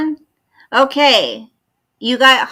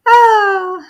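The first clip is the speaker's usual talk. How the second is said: touched aww; 10 semitones higher, 8 dB louder than usual. Real, not acted. Touched by viewer's message.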